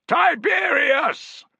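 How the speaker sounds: very slow, high, loud drawn-out shout; the soldiers echo the name with full force. Acted. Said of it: "shouted the soldiers".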